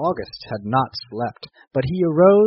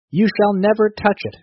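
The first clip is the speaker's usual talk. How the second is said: higher-pitched, understated storybook scream; raised voice of protest; mild scream; 8 semitones higher, 4 dB louder than usual. A narrator's reading. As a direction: cry out in defiance, without a full scream.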